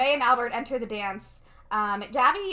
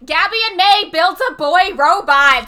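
high, loud mock declaration; mock loud announcement; 7 semitones higher, 14 dB louder than usual. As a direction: blurt it out like a big reveal. Mock quote of what she never said.

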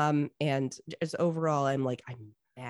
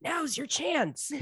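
high, slow urgent prompt; hammed-up conspiratorial urging; urging schemer; 10 semitones higher, slower than usual. Mother egging on her favorite son, high and urgent.

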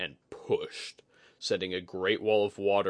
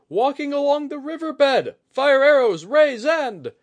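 high, loud shouted orders; crying out; 12 dB louder than usual. Acted. Lookout calling for fire arrows, crying out. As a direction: yell the alarm across the wall. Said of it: "Oguri cried out".

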